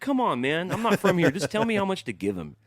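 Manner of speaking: high, loud exasperated plea; pleading shout. Retold or imitated. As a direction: beg the bouncer, voice cranked up. Begging the bouncer for a price.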